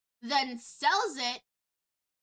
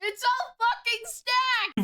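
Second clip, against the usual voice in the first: higher, louder, slower repeat; indignant outburst; 10 semitones higher, 5 dB louder, slower than usual. Real, not acted. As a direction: drag it out in outrage. Repeats with more force.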